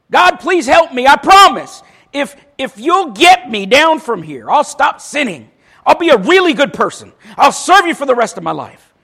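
pleading. Acted. Jack pleads with God.

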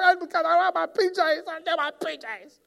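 high, loud mock child wail; hammed-up child meltdown; whining child tantrum. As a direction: shriek it like a kid who lost their PJs. Child voice continues.

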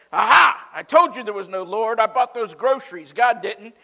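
loud, high, triumphant taunting; spring out and gloat. Acted. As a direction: neighbor jumping out to mock the lady. Neighbor gloating from the bushes.

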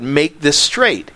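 "Make this straight" spoken very slowly, word by word; slow right down on "Make this straight"; slow deliberate repeat. Shown as a demonstration. Pastor driving the verse home, slow and deliberate. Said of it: "what we're thinking is this".

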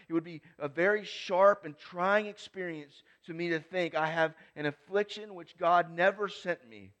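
loud, high, ringing recitation; preaching a quote. Acted. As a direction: declaim it from the pulpit. Quote read at full force.

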